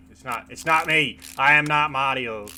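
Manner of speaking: loud, playful protest; mock shout. Gamer loudly denies being Mario, playful mock shout. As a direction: shout the denial for fun.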